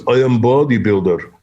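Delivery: put-on thick foreign-accented English; mock heavy European accent; imitated foreign accent. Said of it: "he had an accent".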